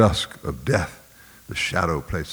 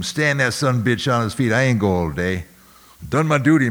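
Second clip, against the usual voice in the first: higher, louder, insistent overseer voice; overseer's rough order; 6 semitones higher, 4 dB louder than usual. Acted. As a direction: push back hard like a defensive overseer. Overseer justifying himself to Major.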